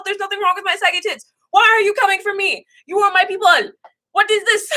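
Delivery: venting hurt at her own people; upset and indignant. Real, not acted. Ranting at commenters over saggy tits, high and hurt. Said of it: "I'm way too emotional".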